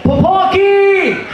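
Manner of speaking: very high, slow drawn-out yells; high long calling shouts; yelling for the cat. Retold or imitated. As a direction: call the cat across a parking lot, stretching each name. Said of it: "Just yelling".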